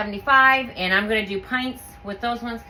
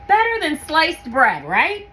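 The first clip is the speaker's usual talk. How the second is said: playful delight; 6 semitones higher, 5 dB louder than usual. Real, not acted. Playful boast.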